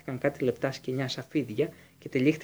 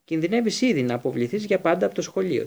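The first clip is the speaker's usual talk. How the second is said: curt; 5 semitones higher, 5 dB louder than usual. A narrator's reading. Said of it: "της είπε κοφτά ο Σνέιπ".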